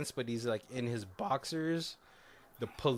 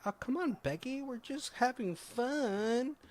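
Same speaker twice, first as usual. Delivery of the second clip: put-on coaxing girl; 11 semitones higher, 3 dB quieter than usual. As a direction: imitate a girl coaxing her friend. Voices girl coaxing her friend.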